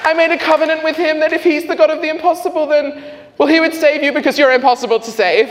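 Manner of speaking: very high, loud, rapid mother impression; jubilant mock shrieking. Mother hearing of her son's conversion, jubilant high imitation. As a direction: shriek with joy and rush the explanation.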